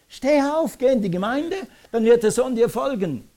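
impassioned, high-pitched command; urgent exhortation. Real, not acted. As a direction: urge them to get up and go. Preacher urging parents to act.

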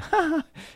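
short high laugh; quick, high-pitched ha-ha; amused laugh. Real, not acted. Amused at Jesus having to instruct them.

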